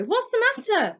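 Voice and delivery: high, loud worried question; boy pressing his frightened cousin; alarmed Harry. A narrator's reading. Harry startled by fleeing Dudley.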